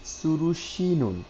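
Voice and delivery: সুরু শিনুন said high and very slow with a tight শি; tense consonant demo. Shown as a demonstration. Teacher modelling the tense sound for a student, slow and high. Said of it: "এখানে সেও tight হয়ে গেছে".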